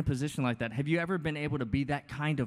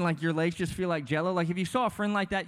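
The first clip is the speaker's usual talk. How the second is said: ordinary talk, nothing marked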